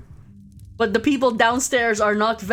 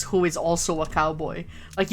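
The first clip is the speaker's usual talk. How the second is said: usual speaking voice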